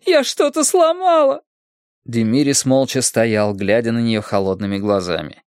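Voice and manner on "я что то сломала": very high, anguished plea; sobbing in pain. A narrator's reading. Injured wife lying below the stairs, sobbing in pain. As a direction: wail for help through the pain. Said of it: "рыдала от боли".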